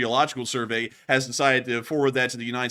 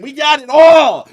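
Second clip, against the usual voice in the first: excited; 11 semitones higher, 15 dB louder, much slower than usual. Real, not acted. Hyped over marmot picture.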